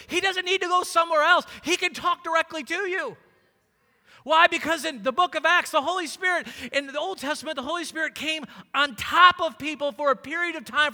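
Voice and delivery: loud, high, emphatic sermon delivery; full-force preaching. Real, not acted. Preacher telling church God will speak to them, full-force preaching.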